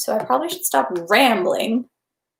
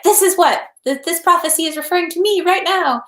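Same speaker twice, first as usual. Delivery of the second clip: mock prophet; 8 semitones higher than usual. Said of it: "people ... are like".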